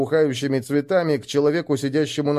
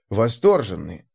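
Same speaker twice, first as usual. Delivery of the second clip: ordinary delivery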